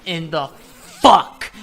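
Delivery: drawn-out swearing, last word blasted; mock dumbfounded outburst; stunned disbelief. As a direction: stretch it slowly, then hit it loud. Stunned as another enemy appears.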